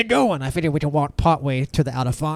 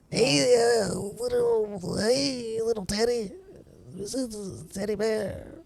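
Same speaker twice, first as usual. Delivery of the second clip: put-on high, quavering little old lady; 8 semitones higher, 5 dB quieter, much slower than usual. Shown as a demonstration. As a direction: croon it like a sweet old granny. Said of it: "can we hear your old lady voice?".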